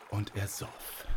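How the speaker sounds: soft, understated first round of the drinking; hushed narration. A narrator's reading. Narrator tells children the giant starts drinking, hushed. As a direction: begin the repetition softly, leaving room to build.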